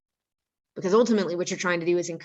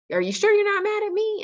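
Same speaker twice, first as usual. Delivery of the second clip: very high, quick questioning voice; mock-innocent question; 4 dB louder, faster than usual. Principal checking with upset student, high-pitched mock innocence. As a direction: ask it lightly, way up high. Acting out calm challenge to student.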